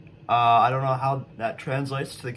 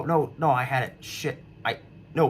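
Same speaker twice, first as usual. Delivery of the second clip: ordinary talk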